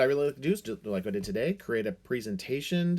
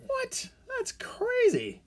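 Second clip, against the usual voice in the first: surprised; slower than usual. Pretend. Pretending to discover a duck.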